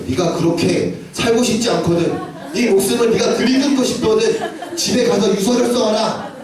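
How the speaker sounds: acted-out teacher's slow pronouncement; imitated counselling teacher. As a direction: hand down the order emphatically, as a teacher. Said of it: "이 선생님이 나한테 유서를 쓰래요".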